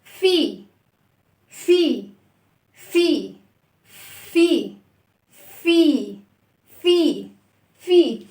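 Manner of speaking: "في" said long and loud with a short i; loud teaching model of "في"; fi sound demo. Shown as a demonstration. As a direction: stretch out a clear "في" for the kids. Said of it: "اسمع كده".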